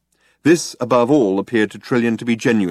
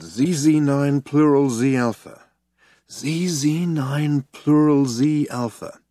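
very slow, higher, drawn-out repetition; slow pondering; 5 semitones higher, slower than usual. A narrator's reading. Zaphod puzzles over the sector name, slowly pondering. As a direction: turn each syllable over slowly, puzzled.

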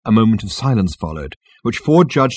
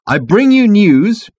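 louder, higher, very drawn-out proclamation; insistent announcement; 12 semitones higher, 7 dB louder, much slower than usual. A narrator's reading. Ford presses on past the captain, insistent. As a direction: proclaim it slowly, refusing interruption. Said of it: "Ford refused to be headed off".

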